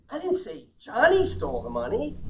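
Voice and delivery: "Johnny" punched high, line rising to "money"; slow, with "Johnny" singled out; stress on JOHNNY. Shown as a demonstration. Trainer hinting someone else did it, stress on JOHNNY. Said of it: "Someone else stole it".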